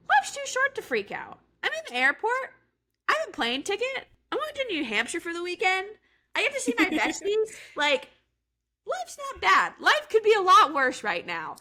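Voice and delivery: sing-song high reassurance to oneself; upbeat self pep talk. Retold or imitated.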